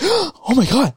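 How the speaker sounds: high, alarmed oh my God; mock panic. Friend mimicking his girlfriend's panicked outburst. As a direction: sound suddenly alarmed. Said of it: "she scares me every single time".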